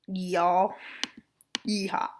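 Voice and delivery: lightly put-on Southern US accent, slow; a hint of a Southern cowboy accent; mock Southern drawl. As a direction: tease yourself with a Southern y'all. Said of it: "What am I, a southerner?".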